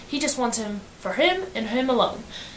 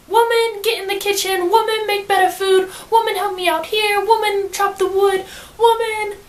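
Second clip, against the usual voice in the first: bossy husband; 8 semitones higher than usual. Retold or imitated. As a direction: ham up a lazy husband ordering his wife about. Logan bossing Janie around.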